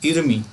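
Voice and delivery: इरमी with the म sliding into ई; linked reading. Shown as a demonstration. Teacher repeats the correct reading इरमी for students. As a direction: say इरमी as one smooth word, not इरम ई. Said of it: "इसको पढ़ा जाएगा इरमी".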